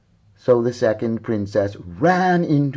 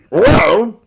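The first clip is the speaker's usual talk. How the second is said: stern king; 10 semitones higher, 10 dB louder than usual. Acted. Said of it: "said the king".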